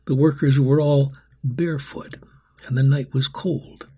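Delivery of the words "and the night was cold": ordinary delivery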